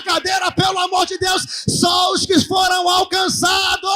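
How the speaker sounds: high, loud preacher's shout; full-force preaching. Real, not acted. Preacher crying out to the congregation, full-force shout. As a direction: shout it out to the congregation, drawing out the last words.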